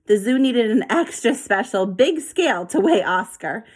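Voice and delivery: ordinary delivery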